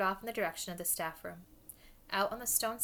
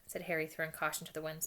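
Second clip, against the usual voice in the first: usual speaking voice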